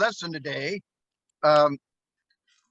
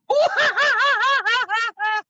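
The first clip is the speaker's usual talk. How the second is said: very high, loud pulsing wail; hammed-up cartoon bawling; mock wailing cry; 5 dB louder than usual. Acts out the sad lover crying.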